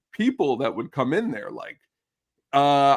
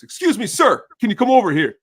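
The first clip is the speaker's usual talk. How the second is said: loud, high-pitched customer hailing a server; put-on demanding customer; calling out; 7 semitones higher, 8 dB louder than usual. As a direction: call across the restaurant for attention. Acting out customer calling him over.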